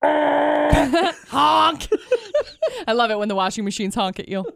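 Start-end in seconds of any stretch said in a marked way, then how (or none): 0.0-2.8 honking noise. Retold or imitated. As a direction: honk like a washing machine. Imitating a washing machine honk.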